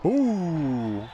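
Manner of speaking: a loud wincing ooh at the hit; ooh, reacting to a huge sack; surprised ooh. Real, not acted. Play-by-play caller reacting to a big hit, loud ooh.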